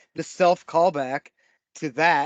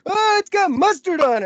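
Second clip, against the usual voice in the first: put-on whiny kid voice; 6 dB louder than usual. Kid quoted whining about mustard.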